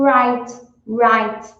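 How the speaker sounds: a loud, careful English "right". Shown as a demonstration. Teacher modeling the English r of "right". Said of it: "posicionar sua língua".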